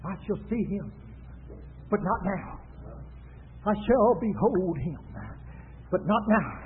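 low, soft, halting solemn quote; hushed prophecy. Retold or imitated. Preacher quotes Balaam trying again, hushed low prophecy. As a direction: measure out each phrase with long pauses. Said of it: "He tries it again".

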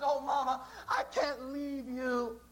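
slow, tearful plea; slow, mildly tearful farewell; weeping plea. Acted. Orpah to mother-in-law Naomi, slow tearful pleading. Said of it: "weeps and cries".

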